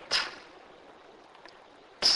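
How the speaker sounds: isolated "t", like the T in take; "t" as a lone puffed consonant, as in take; TH sound demonstrated. Shown as a demonstration. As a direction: model a bare "t" sound, no vowel name. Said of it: "pronounced like the T in the English word take".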